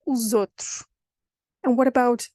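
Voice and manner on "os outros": "os outros" as one word, "oozotroosh"; connected "os outros" with a z link. Shown as a demonstration.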